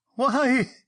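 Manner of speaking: sudden high, loud yelp. A narrator's reading. Marchbanks, seeing something unseen, yelps high and loud. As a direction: yelp it out like a shot animal. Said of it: "he yelped in a high voice".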